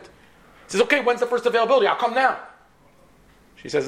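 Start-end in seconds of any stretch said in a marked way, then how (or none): 0.8-2.4 high, fast, eager caller. Retold or imitated. Patient seizes secretary's offer of an appointment, eager. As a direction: jump at the offer, pitched up and quick.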